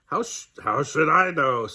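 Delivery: put-on scratchy, grumpy old owl; a cross owl brushing her off. Owl answers crossly in story.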